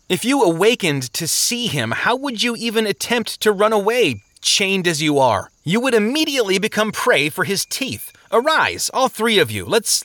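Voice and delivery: urgent warning building to high-pitched calls; plead, then rally them insistently. Acted. Traveler warns sleepers of the stalking enemy, increasingly urgent.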